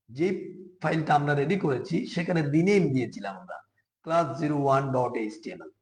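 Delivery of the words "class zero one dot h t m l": very slow, each letter spaced apart; careful, unhurried reading of the filename; slow dictation. Shown as a demonstration. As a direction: dictate the filename slowly so beginners can follow. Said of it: "কথাগুলো একটু আস্তে আস্তে বলতেছি".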